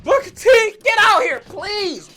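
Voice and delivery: mock terrified wife. Sharmell introducing Booker T while terrified, a high panicky mock plea. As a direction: imitate a shaking wife begging her husband to hurry. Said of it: "she's just shaking, trembling".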